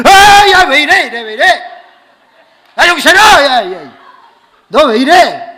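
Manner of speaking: high, very loud alarmed yelling; panicked shout. Retold or imitated. Pastor fearing the girl is possessed, panicked shouting. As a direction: yell as if trying to snap someone out of it.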